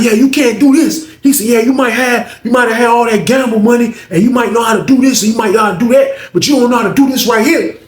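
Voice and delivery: high, loud imitated showing-off; taunting boast. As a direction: act out gloating over a rival. Alvin boasts, taunting Booker.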